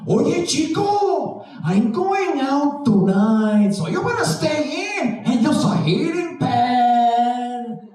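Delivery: put-on high, slow Mexican accent voice; the back talks in a slow, high Mexican accent. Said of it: "why my back is Mexican".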